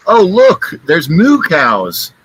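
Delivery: mock excitement, pitched high then drawn out; imitated excited sighting. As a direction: act out someone gleefully pointing out the view. Passenger spotting cows, retold.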